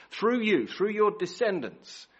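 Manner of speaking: 1 proclamation. Acted. God's promise repeated.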